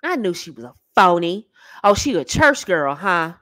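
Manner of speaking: low, knowing male imitation; gloating man. Man who got her talking freaky, low gloating. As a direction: drop low and gloat, as if proven right. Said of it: "He's going".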